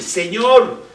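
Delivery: Señor dicho alto y fuerte; indignant call. Retold or imitated. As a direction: reclama llamándolo. Marta llama a Jesús. (Marta llama a Jesús, indignada y fuerte)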